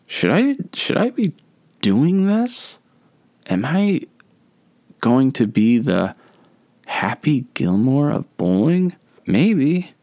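high, lilting inner voice, half joking; mock-dreamy daydream voice; wondering aloud. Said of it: "And I'm like".

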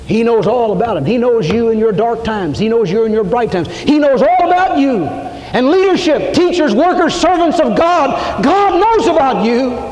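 rising sermon crescendo; preaching at full force. Acted. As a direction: hammer home that God knows. Preaching climax.

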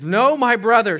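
high, loud pleading cry. Retold or imitated. Sister pleading with her brother, crying out. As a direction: beg him to stop. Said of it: "she cried".

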